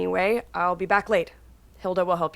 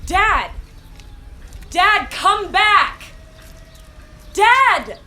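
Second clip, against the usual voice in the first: high, loud shouts after him; calling out; 13 semitones higher, 8 dB louder, much slower than usual. Acted. Daughter shouting after her father walking toward the shore. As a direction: yell for him to come back, desperate.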